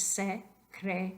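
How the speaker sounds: secretaria split as se-cre-ta-ria; syllable by syllable. Shown as a demonstration. Teacher shows the diphthong, secretaria syllable by syllable. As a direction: say secretaria in four pieces, "ria" as one syllable. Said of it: "solamente son una sílaba".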